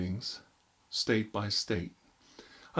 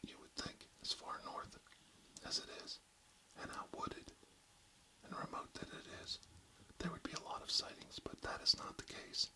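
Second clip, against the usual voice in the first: ASMR whisper, close to the mic; barely audible, intimate whisper; 5 semitones higher than usual. Acted. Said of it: "real close to inaudible whispers today".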